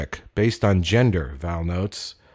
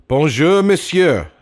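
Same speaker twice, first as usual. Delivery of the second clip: high, loud pupils' reply; class chorus; 7 semitones higher, 7 dB louder than usual. Acted. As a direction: answer like a class reciting together. Class answers teacher.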